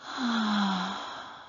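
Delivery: drowsy exhale into sleep; sleepy sigh. A narrator's reading. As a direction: sigh as sleep takes over. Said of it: "with a sigh".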